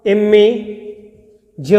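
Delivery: इमेज drawn out as im-mij; slow correct pronunciation. Shown as a demonstration. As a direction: stretch इमेज to show each sound. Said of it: "देखिए कैसे होगा". (धीरे-धीरे खींचकर इमेज)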